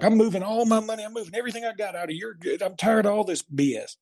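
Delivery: high-pitched mock rant; retold angry rant. Dad threatening his financial guy over the money, imitated angry rant. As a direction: imitate Dad's fed-up tirade, pitched well up.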